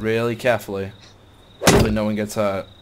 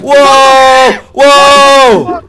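much higher, much louder whoa; startled shout; 13 semitones higher, 14 dB louder than usual. Real, not acted. Player yells in shock at a sudden kill. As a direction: yell out in shock.

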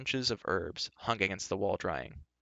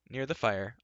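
usual speaking voice

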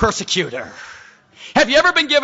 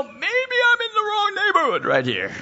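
mock nervous realization; 13 semitones higher than usual. Preacher voices Joel realizing he's surrounded, high mock-nervous squeak. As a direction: imitate someone squeaking as danger dawns. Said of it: "he finally senses".

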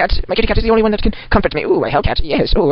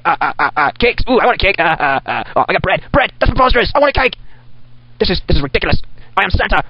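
usual speaking voice

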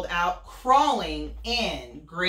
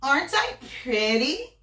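higher preening granny; vain Granny; 9 semitones higher, 4 dB louder than usual. Acted. Granny preening in front of the wolf. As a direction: fish for compliments.

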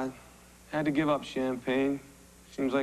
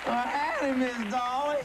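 hammed-up hillbilly country accent, higher; cornball country accent; 4 semitones higher than usual. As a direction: lay the country accent on thick. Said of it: "you're doing this cornball accent for".

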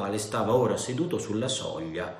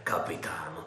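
lower, softer muttered aside; under his breath; 5 semitones lower, 7 dB quieter than usual. A narrator's reading. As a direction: say it to yourself. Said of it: "a mezza voce, come parlasse tra sé".

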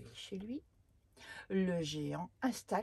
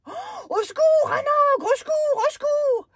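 high, loud panicked cries; screaming for help; 21 dB louder, faster than usual. Acted. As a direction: shriek for help in terror. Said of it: "crie le géant".

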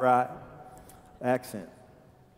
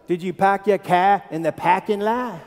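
broad Boston townie, r's dropped; thick mock Boston accent. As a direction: imitate a Boston local with flat-A "cah" and "pahk". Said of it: "Boston has a unique accent".

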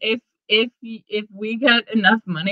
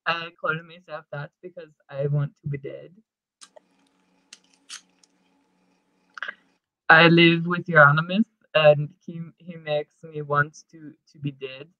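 mock gloomy [name]; 5 semitones lower, 5 dB quieter than usual. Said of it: "they sit down to do interviews".

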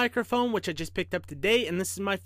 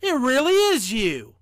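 higher, louder amazed engineer; raised storybook wonder; 5 semitones higher, 7 dB louder than usual. A narrator's reading. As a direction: marvel at it out loud. Engineer astonished the train really talks.

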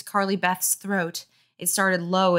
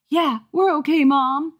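put-on higher, louder kid reply; high boy voice; 8 semitones higher, 5 dB louder than usual. A narrator's reading. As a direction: answer like a young boy.

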